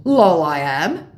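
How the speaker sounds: slow, loud scammer comeback; insisting mock scammer.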